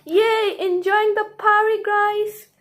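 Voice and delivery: high, loud cheering; party excitement. Acted.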